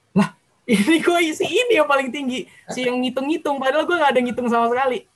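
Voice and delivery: high-pitched retold disbelief with a laugh; incredulous. Seeing the guessed section scored highest, high-pitched disbelief. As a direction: re-enact the shock, voice jumping up high.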